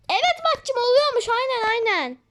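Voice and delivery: high mocking agreement; lightly voiced teasing sibling; a hint of teasing kid. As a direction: tease your brother sing-song.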